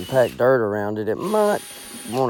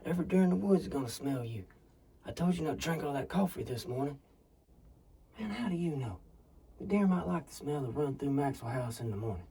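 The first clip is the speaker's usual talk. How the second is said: whispering; 2 semitones higher, 13 dB quieter, faster than usual. Acted. Hunters whispering in a blind.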